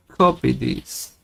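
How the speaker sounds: ordinary talk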